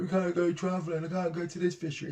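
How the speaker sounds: half-hearted impression of complaining anglers; mock whining. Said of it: "everybody crying".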